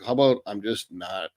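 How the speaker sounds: usual speaking voice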